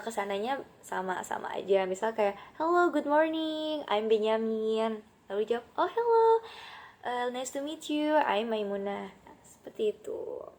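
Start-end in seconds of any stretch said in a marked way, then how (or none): 2.6-5.0 high, slow good morning; voicing a greeter. Acted dialogue greeting. 5.8-9.1 oh hello pitched up very high; delighted reply. Acted. Acted reply in dialogue.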